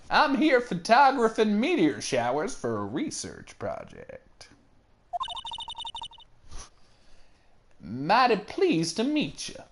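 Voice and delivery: lightly put-on Southern accent for Lotta; a friendly Southern belle introducing herself, light Southern accent. Photographer greeting locals, in a light Southern accent.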